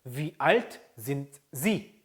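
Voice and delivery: "Wie alt sind Sie" slowly, word by word; careful model pronunciation with gaps between words; slow, separated words. Shown as a demonstration. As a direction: keep the T of "sind" apart from "Sie". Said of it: "빨리 발음하다 보니까".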